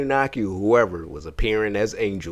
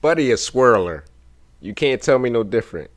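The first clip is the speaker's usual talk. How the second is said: usual speaking voice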